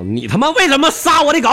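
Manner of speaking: furious yell; angry shout. Acted. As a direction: gang boss raging at the killer. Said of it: "愤怒的就喊道".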